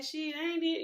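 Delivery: high retold reply. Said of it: "I was like".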